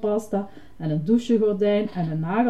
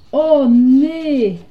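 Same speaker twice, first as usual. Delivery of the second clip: high, loud cry of dismay; alarmed dismay; 5 semitones higher, 9 dB louder than usual. Acted. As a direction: burst out in sudden alarm. Ernie discovers Ducky is missing. (geschrokken, luide uitroep)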